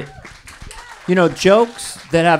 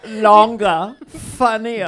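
punchy comic pitchman, louder on the first word; ad-read impression; 5 dB louder, slower than usual. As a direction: sell it in a put-on voice. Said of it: "Dana does impressions in most of the ads".

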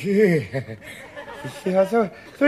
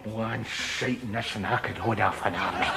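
ordinary talk, nothing marked

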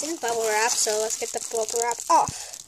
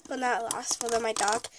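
normal talking voice

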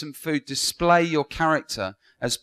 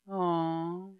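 imitating the room's aww; 4 semitones higher, 8 dB quieter than usual. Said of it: "The whole room went".

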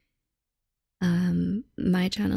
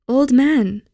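loud, higher call across the water; 5 semitones higher, 8 dB louder than usual. A narrator's reading. Will-o'-wisps calling after the ferryman, loud. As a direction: call out after him from afar. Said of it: "they called to him".

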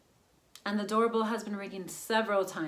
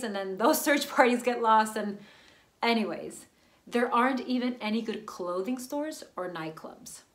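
ordinary talk, nothing marked

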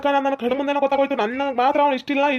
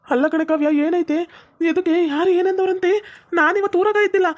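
put-on higher-pitched voice for the young suitor; young man's voice; 6 semitones higher than usual. Sundra answers Kavya's phone call, higher puzzled young man voice.

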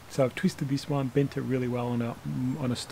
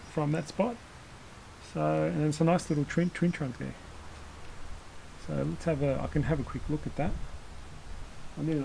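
ordinary delivery

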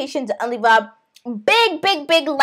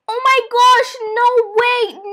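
raised, acted shock; shocked refusal; 8 semitones higher, 4 dB louder than usual. Child told to go beg for money, shocked refusal. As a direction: refuse it in disbelief.

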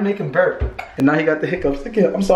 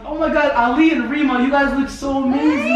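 excited cooing; 7 semitones higher, slower than usual. Real, not acted. Cooing at kids for photo.

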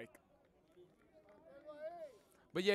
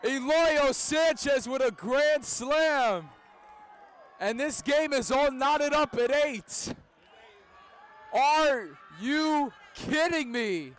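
roaring grand slam home run call; radio baseball announcer; 8 semitones higher, 8 dB louder, slower than usual. Real, not acted. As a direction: yell it out as the ball leaves the park.

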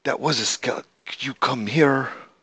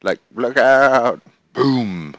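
louder, slow mock shout; mock warning; 3 semitones higher, 8 dB louder, much slower than usual. Gamer scolding the sheep, mock shouted warning. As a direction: pretend the sheep yells a warning. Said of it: "Did you even warn me".

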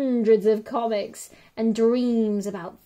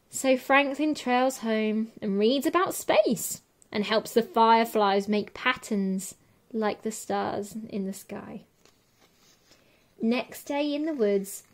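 usual speaking voice

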